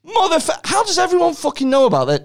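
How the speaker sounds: high-pitched comic exasperation; exasperated outburst. Real, not acted. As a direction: blurt it out in exasperation. Thunder stolen, co-host knows the fact.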